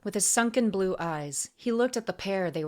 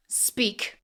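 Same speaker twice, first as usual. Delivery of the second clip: terse, higher demand; 4 semitones higher than usual. A narrator's reading. Assassin gripping the auctioneer, demanding. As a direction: demand it in one word. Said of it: "she demanded".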